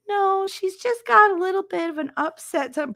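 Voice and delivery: Nurse Helen's voice. Retold or imitated. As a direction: greet sweetly like a calm school nurse.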